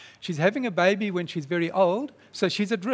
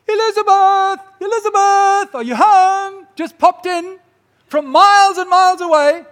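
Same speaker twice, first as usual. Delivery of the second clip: high, loud, cheery call through the house; Mary calling out; 12 semitones higher, 12 dB louder than usual. Acted. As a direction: call out brightly to a cousin inside.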